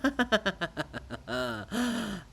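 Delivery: long mocking laugh. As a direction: laugh at the joke. Chorus laughs.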